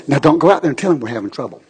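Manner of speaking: low, hurried instruction to a spouse; rushed confidential caution; mock furtive warning. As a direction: rattle it off low, keeping it between you. Spouse's warning before church.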